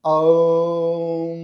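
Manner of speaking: "আউম" very long and loud on one level note, a-u into humming m; Aum from the navel. Shown as a demonstration. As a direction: let "আউম" rise from the navel and ring on. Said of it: "নাভি থেকে উঠে আসবে".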